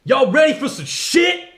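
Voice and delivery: high, loud show-intro yell; hype shout. Acted. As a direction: yell it like a TV host opening.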